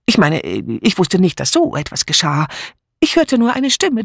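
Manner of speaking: high, fast, dithering spinster voice; flustered Miss Murgatroyd. A narrator's reading. Miss Murgatroyd fumbling her alibi for the inspector, flustered.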